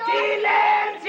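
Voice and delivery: un grito muy alto y lento, agudo; ¡calla a todos de golpe!; grito furioso. Acted. Don Ramón calla a todos. (grita furioso para callar a los niños)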